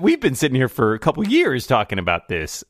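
ordinary talk, nothing marked

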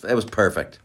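normal talking voice

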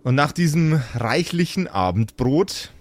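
calm storyteller, slowing and sinking as night falls; storybook scene narration. A narrator's reading. Game master tells the players how the night passes, calm storyteller voice. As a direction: narrate the night settling over the sleeping heroes.

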